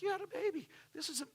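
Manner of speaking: mock astonishment at the news; surprised. Telling the congregation how he reacted to the baby, surprised. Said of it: "And I'm like".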